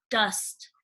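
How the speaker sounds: ordinary delivery, nothing marked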